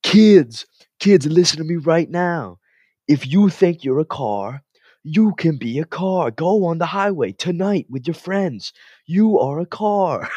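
high, loud, slow overblown yell; comedy-sketch imitation, shouted and stretched; mock Garnett. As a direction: ham it up like a parody of a champion's rant. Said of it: "they go".

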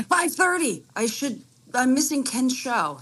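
high, retold fluster at the time; acted dismay. Caller retelling that she nearly missed the show, acted dismay. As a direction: mimic your own panic at running late.

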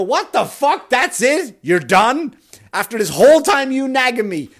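high, loud mock outrage; outraged disbelief. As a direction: yelp it in stunned indignation. Outraged at abrupt app ending.

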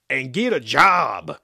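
loud, slow, drawn-out farmer's yell; parting shout. A narrator's reading. Farmer shouts at the departing animals' backs. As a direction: holler it after them, stretching the words. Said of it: "the farmer shouted at their backs".

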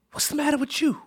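put-on high-pitched old grandmother voice; high voice, imitating grandmother. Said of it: "and my grandmother".

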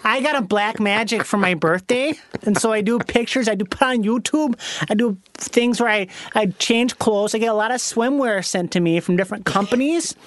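caricatured Wisconsin bikini contestant; chatty mock Upper Midwest accent. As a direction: lay the Wisconsin vowels on thick. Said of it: "talked like that".

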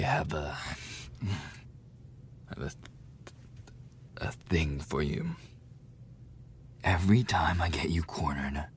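fast whisper fading to barely a breath; intimate whisper. Acted. Bully whispers longing to the cornered victim. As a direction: confess the desire in a hush.

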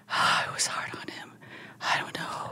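imitated breakdown, barely above a whisper; broken-down tough guy. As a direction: sigh it out softly, retelling a man's collapse. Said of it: "tough-ass guy broke down".

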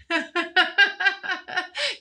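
a quick giggle; light giggle. Real, not acted. Laughing at own remark.

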